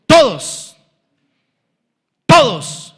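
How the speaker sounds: each TODOS loud and falling, set apart by pauses; emphatic repetition. Real, not acted. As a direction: punch "todos" separately each time. Preacher hammers a repeated key word.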